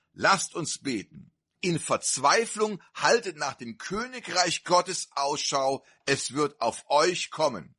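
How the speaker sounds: ordinary talk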